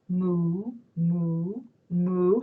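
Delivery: low, soft moos; gentle low cow imitation; cow mooing. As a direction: moo quietly like a placid cow. Voices the cow.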